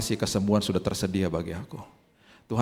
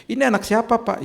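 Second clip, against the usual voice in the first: nosy question; 12 semitones higher, 4 dB louder than usual. Retold or imitated. People asking whose child.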